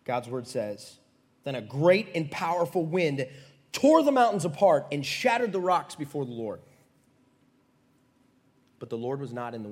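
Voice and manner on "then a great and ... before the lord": loud, raised-pitch declamation; dramatic scripture reading. Acted. Preacher reading of wind shattering rocks, thunderous. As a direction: thunder it out like the storm.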